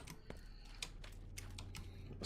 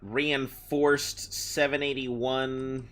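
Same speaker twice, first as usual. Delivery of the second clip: ordinary talk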